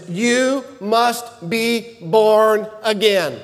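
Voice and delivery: "You must be born again" said slowly, each word weighed; slow, deliberate quote. Acted. Jesus telling Nicodemus, slow and deliberate. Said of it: "the first thing he tells them".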